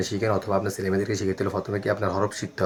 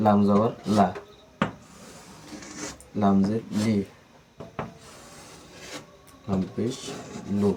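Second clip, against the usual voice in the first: long pauses between লাম জবর লা, লাম জের লি, লাম পেশ লু; teacher models syllables for students to copy; slow spelled-out drill. Shown as a demonstration. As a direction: spell out each syllable, pausing to let students repeat. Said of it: "teacher demonstrates syllables slowly".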